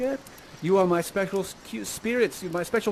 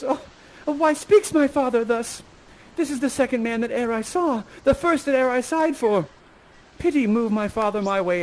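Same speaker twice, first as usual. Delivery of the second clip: higher-voiced pleading daughter; Miranda; 6 semitones higher than usual. Miranda turns beet red at her father, high pleading. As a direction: plead high as a flustered young heroine. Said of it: "turns beet red and she says".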